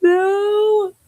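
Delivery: high, loud protest at the pun; a squealed groan of disbelief; mock outraged no. Real, not acted.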